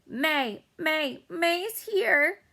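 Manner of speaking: high drawn-out shout of her own name. A narrator's reading. Girl shouting through a car window, high and drawn out. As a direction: call out slowly, announcing yourself. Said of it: "she shouted through the open window".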